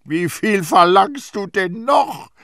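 high, loud, drawn-out demand; yelled, stretched-out question; exasperated shout. A narrator's reading. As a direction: shout at her in outrage. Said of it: "schrie Terrier sie an".

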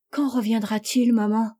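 understated anxious question; slightly trembling. A narrator's reading. Bess asks mother when father returns, slightly trembling voice. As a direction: ask with just a touch of tremor. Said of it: "dont la voix tremblait un peu".

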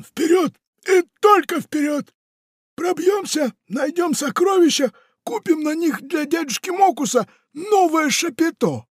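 very high, loud battle cry; rally the troops; rallying pirate. Acted. Pirate rallies Funtik forward, very high and shouting.

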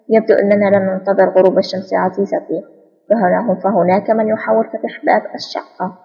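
plain reading voice

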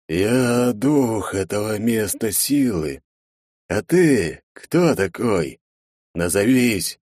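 slow, distant, commanding spirit; ancient spirit voice. A narrator's reading. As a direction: speak slowly like an otherworldly guardian.

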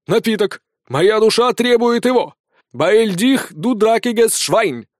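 high, loud histrionic outburst; ranting demand. A narrator's reading. Sergeant's soul demands schnapps, ranting. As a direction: rant and slip into mock German.

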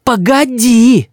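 put-on high, drawn-out childish threat; threatening little boy. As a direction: warn grandpa slowly in a boy's voice. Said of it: "сказал Рис".

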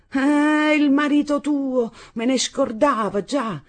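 high, loud, slow sarcasm; shrill high notes. A narrator's reading. Mother pretending she forgot the husband, in shrill notes. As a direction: rise up into piercing, drawn-out notes. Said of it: "ergendosi con certe note acute nella voce".

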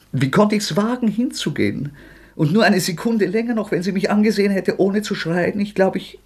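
panicked memory racing ahead; agitated outburst. Acted. Rodja reliving the murder for Sonja, rising agitation.

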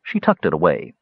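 ordinary delivery, nothing marked

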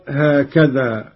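ordinary talk

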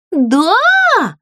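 high, loud gleeful yes; joyful cry. A narrator's reading. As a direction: leap up with delight. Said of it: "радостно подпрыгнула Злюня". (радостный возглас, высоко и громко)

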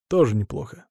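ordinary delivery, nothing marked

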